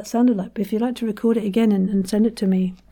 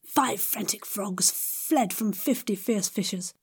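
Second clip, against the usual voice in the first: five frantic frogs said softly, slower; quiet and slow; 9 dB quieter than usual. Shown as a demonstration. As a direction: say it gently and slowly. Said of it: "tongue twister, slower softer take".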